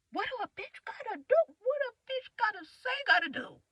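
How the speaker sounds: high pleading. Real, not acted. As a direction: plead upward in exasperation.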